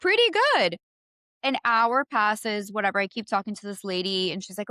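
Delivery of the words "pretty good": sarcastic echo. Real, not acted.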